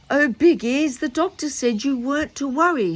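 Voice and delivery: put-on high, bright little boy voice; a young boy soothing his old friend, voiced high; Noddy. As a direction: pitch it up as eager little Noddy.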